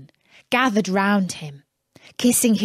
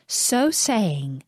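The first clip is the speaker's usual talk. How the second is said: ordinary delivery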